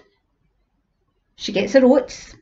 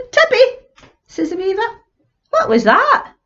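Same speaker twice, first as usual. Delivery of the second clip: startled weaver; 13 semitones higher than usual. Acted. Weaver calling to wife, startled by noise. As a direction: call out high in alarm.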